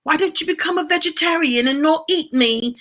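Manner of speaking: high-pitched, pleading little fish voice; sad fish. Pretend. Sad trout asking its eater for mercy, high mock-mournful plea. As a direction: voice a mock-pitiful fish begging for its life. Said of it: "because the fish looks sad".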